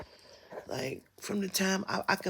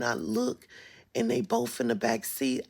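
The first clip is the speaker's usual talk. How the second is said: normal talking voice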